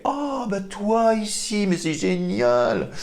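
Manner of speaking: be amazed to see them; surprise demo. Shown as a demonstration. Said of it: "l'émotion de la surprise". (surprise ravie, beaucoup plus aiguë)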